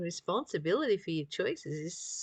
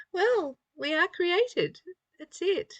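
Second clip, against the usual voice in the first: amused; 11 semitones higher than usual. Real, not acted. Delighted comment after laughing.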